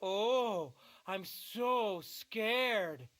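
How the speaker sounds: slow sarcastic mock fear. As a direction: drag it out, not scared at all. Sarcastic reply to threat.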